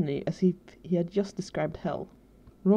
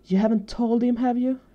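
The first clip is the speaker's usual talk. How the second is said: exasperated; 5 semitones higher, 5 dB louder than usual. A narrator's reading. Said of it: "let out an exasperated sound".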